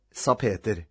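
ordinary delivery, nothing marked

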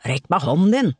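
touch of angry hissing; irate snarl. A narrator's reading. Tore clinging to the rock, irate demand to rescuers. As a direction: demand it with mild, testy force. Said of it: "hørte ham frese iltert".

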